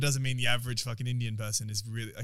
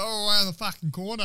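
country Aussie local; 8 semitones higher, 5 dB louder than usual. Retold or imitated. Local corrects a stranger seeking a bathroom, loud mock country Aussie. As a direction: impersonate a brash rural Aussie. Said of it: "I was in country Australia".

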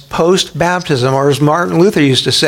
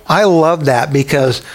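usual speaking voice

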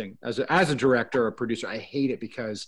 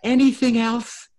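desperate actor; 9 semitones higher, 4 dB louder than usual. Retold or imitated. Actor begging the casting room for another take, high and pleading. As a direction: beg for one more take, voice pitched way up. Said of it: "start going".

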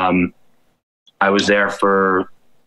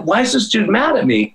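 baffled; 11 semitones higher than usual. Retold or imitated. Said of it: "And I'm like".

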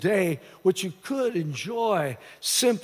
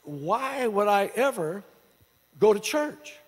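imitated incredulity; slower than usual. Pastor retelling a young man's reaction to a church invitation, incredulous. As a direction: act out a stunned, drawn-out question. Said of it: "he was just incredulous".

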